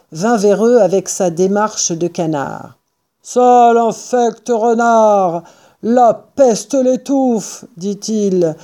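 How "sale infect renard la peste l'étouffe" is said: slow, drawn-out character curse; cursing. A narrator's reading. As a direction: curse the fox, dragging out each word. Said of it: "lança au renard des insultes". (juron rageur et traînant)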